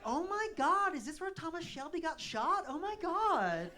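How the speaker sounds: put-on high, gushing American accent; mock-shocked American squeal; American tourist. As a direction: do an excitable American tourist, drawing out the vowels. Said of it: "any Americans in?".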